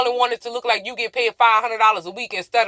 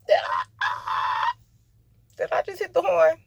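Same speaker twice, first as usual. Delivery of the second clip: startled; 11 semitones higher, slower than usual. Real, not acted. Startled by horn.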